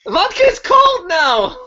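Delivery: loud high panicked shout. Acted. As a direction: yell it in alarm. Panicked reply to frozen bartender.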